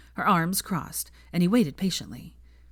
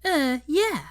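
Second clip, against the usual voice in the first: sneering high mock echo; 10 semitones higher, 3 dB louder than usual. A narrator's reading. Hermione mocking Harry's mumbled answer, sneering. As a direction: throw his word back at him. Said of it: "she mocked".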